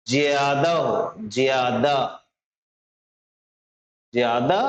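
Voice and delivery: ordinary delivery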